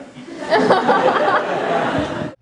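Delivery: loud falling laugh. Real, not acted. Laugh after the outburst.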